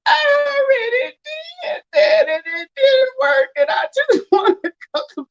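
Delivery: high, slow, tearful wail; crying. Retold or imitated. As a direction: whine it out like you're breaking down in tears. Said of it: "broke down and went to crying".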